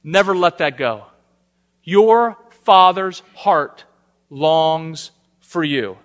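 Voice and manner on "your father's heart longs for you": slow emphatic. Real, not acted. Preacher repeats central point.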